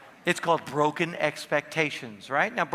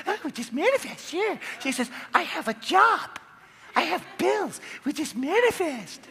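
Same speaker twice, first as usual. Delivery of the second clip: much higher mock-sarcastic wife voice; 9 semitones higher than usual. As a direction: squeak out her sceptical sarcasm, laughing. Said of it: "And she's like".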